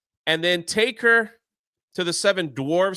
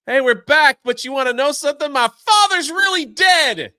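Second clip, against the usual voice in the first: much higher and louder, rushing the middle; hammed-up excited blurt; 9 semitones higher, 7 dB louder than usual. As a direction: spill it out in a giddy rush.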